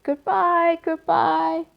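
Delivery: high little calling voices; tiny spider voices. Acted. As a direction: call goodbye from far away. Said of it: "they called".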